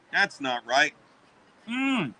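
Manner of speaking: ordinary talk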